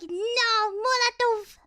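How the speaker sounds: loud, high mock-Russian warning; hammed-up Russian gamer cry. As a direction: shout like an imitated Russian teammate. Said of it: "They're like".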